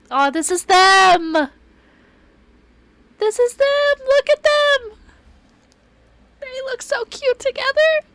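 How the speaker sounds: loud, very high fangirl squeal; ecstatic squealing. Real, not acted. Player finds the couple's picture, ecstatic high squealing. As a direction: shriek with delight at finally seeing them.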